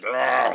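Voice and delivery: loud growling "argh"; rough, loud imitation of a struggle; imitated grunt. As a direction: mimic a fighter's loud grunt. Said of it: "these grunts".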